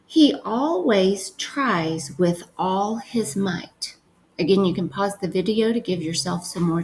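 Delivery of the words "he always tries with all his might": slow, clear dictation. Shown as a demonstration. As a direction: read it slowly for writing. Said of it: "the next sentence you're gonna write is".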